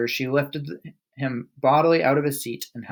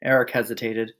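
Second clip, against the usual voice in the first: ordinary delivery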